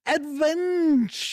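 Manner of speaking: Adventura sung out high and slow; hammed-up catchphrase. Host opening the deal with a grand, hammy Adventura. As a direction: announce Adventura like a grand fanfare.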